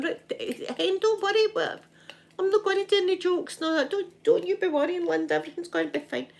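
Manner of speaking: high, cheeky soothing dad voice; dad promising Linda he won't joke, high and cheeky; mock reassuring dad. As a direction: play the dad promising, with a wink. Voicing dad reassuring her.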